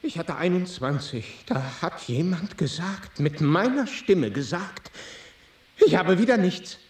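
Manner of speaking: high, soft, bewildered voice; dazed bewilderment. Acted. Player explaining himself after the trance, dazed and high. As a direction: say it small and high, still half in the dream.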